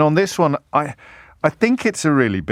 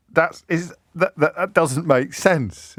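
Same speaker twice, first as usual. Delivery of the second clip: usual speaking voice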